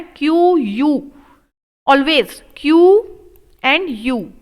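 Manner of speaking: Q यू said slowly, leaning on Q; slow emphatic spelling. Shown as a demonstration. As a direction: drill Q then यू slowly. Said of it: "आपको क्या करना है".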